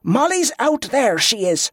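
high, insistent commander's voice; urgent authority without shouting; whip-crack command. A narrator's reading. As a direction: drive the point home, pitch jumping up. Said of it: "her voice cracking like a whip".

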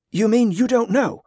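alarmed and pitched high. A narrator's reading. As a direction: blurt it out in alarm. Jordan alarmed, eyes fly open.

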